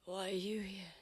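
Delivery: barely voiced, weak and drained; weak whisper. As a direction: whisper it like you can barely stay awake. Dying character whispers to friend.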